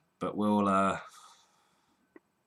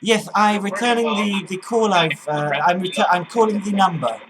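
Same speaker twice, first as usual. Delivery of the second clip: put-on higher, louder voice in an unsure accent; put-on caller voice; 7 semitones higher, 6 dB louder than usual. Prank caller returning a call, put-on voice. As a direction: stumble through it in a put-on voice. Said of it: "didn't really know what accent".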